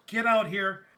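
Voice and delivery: shouted up at the stage; mock callout. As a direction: call him out loudly.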